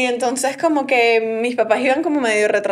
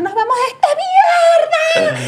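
angry dad exit; faster than usual. Retold or imitated. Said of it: "me agarró a mí y me dijo".

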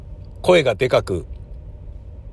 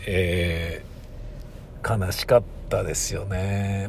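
real sadness; 4 semitones lower, 7 dB quieter, much slower than usual. Real, not acted.

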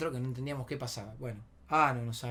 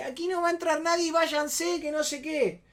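high-pitched, loud mock refusal; mujer atrincherada; 12 semitones higher, 4 dB louder than usual. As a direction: send them away as the imitated woman. Mujer atrincherada echa a todos. (voz aguda imitada echándolos)

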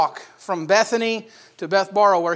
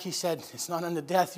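very soft, gentle bedside comfort; soothing reassurance; 3 semitones lower, 10 dB quieter than usual. Acted. Sisters wiping Lazarus's feverish brow, soothing reassurance. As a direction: keep it hushed for the sick one.

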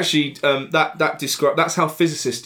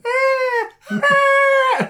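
high crying wail, mock banshee; imitating her high whiny cry; banshee wail impression. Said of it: "sounds like a banshee".

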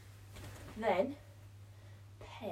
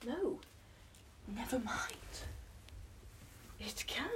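whispering; 11 dB quieter than usual. Real, not acted. Whispering while working.

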